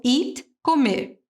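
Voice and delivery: ordinary talk, nothing marked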